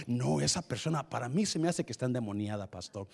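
hushed quick tattling; gossiping churchgoer. Acted. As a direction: whisper the accusation to the pastor. Gossip voiced to pastor.

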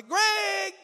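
high, drawn-out acted holler; mother's loud yell for her child; calling out. Mom calling Greg from a distance, loud holler.

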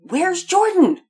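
loud, high urgent shout. A narrator's reading. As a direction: call out to halt the noise. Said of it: "Daddy raised his voice over the uproar".

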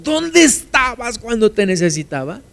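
high, plaintive cry of complaint; reproaching God. Acted. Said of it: "cuando yo le digo a Dios".

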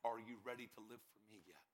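low, hushed voice of God; quiet, grave calling; solemn divine voice. Retold or imitated. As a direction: speak low and soft, with solemn weight. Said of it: "I heard a voice say".

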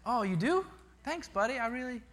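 high, softened dad voice; touched. Retold or imitated. Dad to preschool son while dressing him, touched.

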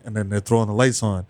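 ordinary delivery

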